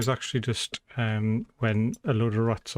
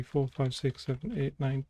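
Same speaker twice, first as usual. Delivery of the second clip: ordinary talk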